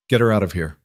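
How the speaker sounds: ordinary delivery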